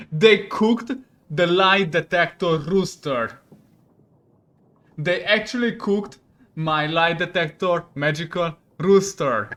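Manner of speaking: loud, slow, incredulous outrage; angry outrage. Real, not acted. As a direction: land each word loudly, furious at the cook. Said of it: "I'm freaking mad".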